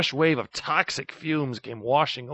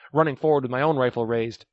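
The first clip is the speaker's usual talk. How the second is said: ordinary delivery, nothing marked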